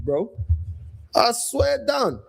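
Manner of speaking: usual speaking voice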